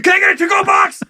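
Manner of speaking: high, frantic comic yell; mock panicked shout. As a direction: shout it over a pounding rave. Diner yelling in imagined chaos.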